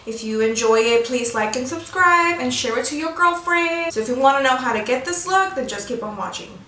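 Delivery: ordinary delivery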